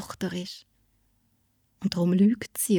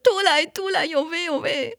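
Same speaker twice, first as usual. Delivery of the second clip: high, loud lament, falling off at the end; loud wail; 10 semitones higher than usual. A narrator's reading. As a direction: wail it out like a beaten girl. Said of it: "dass die laut ruft".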